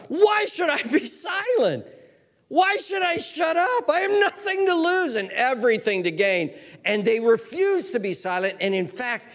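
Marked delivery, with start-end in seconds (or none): 0.1-6.5 high, impassioned protest; defiant shout. Acted. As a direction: demand it defiantly, pitched way up. Defiant beggar voiced by preacher.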